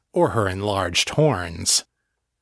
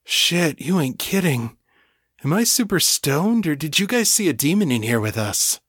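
stoned partygoer; 6 semitones higher than usual. Acted.

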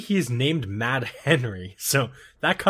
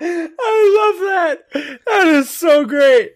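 high, loud words through real laughter; laughing hard; 13 semitones higher, 10 dB louder than usual. Real, not acted. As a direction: talk while cracking up uncontrollably. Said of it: "why I'm laughing".

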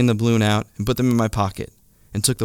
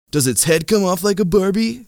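mocking douchey guy; 10 semitones higher than usual. A narrator's reading. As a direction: tease the little kid. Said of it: "he asked".

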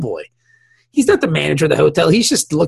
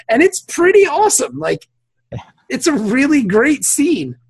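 excited; 7 semitones higher than usual. Real, not acted. Praising the bar fight scene to his co-host, excited. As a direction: pitch jumps way up on pretty.

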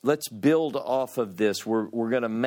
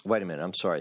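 ordinary talk

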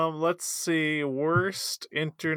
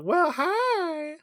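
put-on much higher, sweet Southern accent like a talking cat; 14 semitones higher than usual. Quoting the viral cat video to a friend, high Southern accent. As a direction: imitate the cat's Southern-accented hello. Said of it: "that cat that sounds like it has a southern accent".

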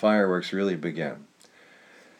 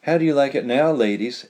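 higher, louder teasing taunt; gloating question; 5 semitones higher, 4 dB louder than usual. A narrator's reading. Tug taunting Jana and Verushka, gloating. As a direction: gloat at the ladies. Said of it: "asked Tug".

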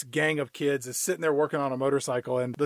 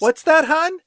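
high, loud call to a spouse next door; put-on sing-out to hubby; pretend calling out; 12 semitones higher, 6 dB louder, slower than usual. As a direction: fake answering someone in the other room. Pretends husband calls from other room.

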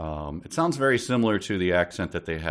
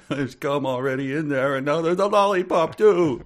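put-on high child's wail, building louder and higher; complaining little kid; 8 semitones higher, 6 dB louder than usual. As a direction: whine it up into a mock tantrum. Voicing little Tommy complaining.

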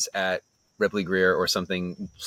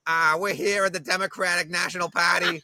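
put-on higher-pitched Boston mayor with a thick local accent; Tom Menino; 8 semitones higher than usual. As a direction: imitate a Boston politician at the podium. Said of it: "clips of Tom Menino".

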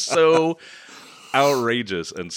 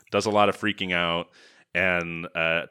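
ordinary delivery

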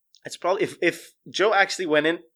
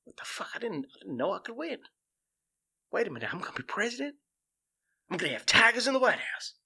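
hushed, disbelieving realisation that rises in pitch; a mock Joe muttering in shock at his own luck; dawning surprise; 5 semitones higher, 3 dB quieter than usual. Imitating Joe, hushed and surprised he could win. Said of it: "he goes".

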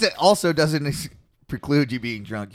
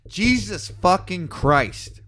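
slow, high, emphatic swearing; exasperated; 6 semitones higher, much slower than usual. Real, not acted. Reader fed up with the list of names, real exasperation. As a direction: drag out each word in exasperation.

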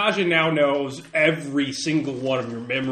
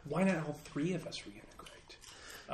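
slow, breathy suggestive whisper; 13 dB quieter, slower than usual. Acted. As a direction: lean in and whisper the offer slowly. Suggestive offer, whispered aside.

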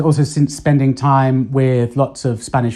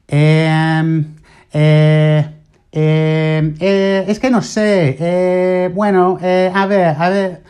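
Spanish-style em and eh, pitched up; Spanish hesitation sounds; 3 semitones higher, slower than usual. Shown as a demonstration. Showing how Spaniards fill pauses, with Spanish-style hesitation. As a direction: stall the way a Spaniard would, a bit higher. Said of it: "it's a kind of higher pitch".

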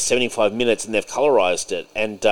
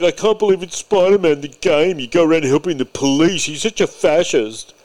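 mock whiny critic; 7 semitones higher, 4 dB louder than usual. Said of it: "they were like".

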